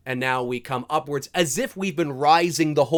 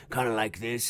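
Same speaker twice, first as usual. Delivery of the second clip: light impression of the actor off-camera; imitating actor's everyday voice; 2 semitones lower, 5 dB quieter than usual. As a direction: lightly mimic how he normally talks. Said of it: "doesn't necessarily sound like that in everyday talking".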